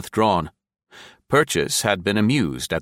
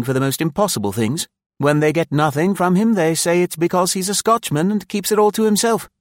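high, chatty youngster spinning a tale; eager young officer; 8 semitones higher, 3 dB louder than usual. Acted. Young officer telling an anecdote to an older listener, high and eager. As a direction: gossip keenly in a high youthful voice.

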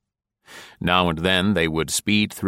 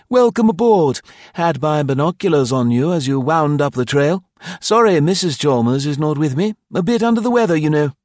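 high, loud, hearty innkeeper; cheerful host; 9 semitones higher, 6 dB louder than usual. A narrator's reading. Host welcomes new guests at the car, cheerfully. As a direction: pitch it up as an eager, cheery host.

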